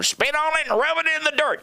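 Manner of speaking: high, tough mom impression; mock mom brushing off a skinned knee; mom's brusque voice. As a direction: bark it like a no-nonsense mom. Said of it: "you'd skin your knee and she'd go".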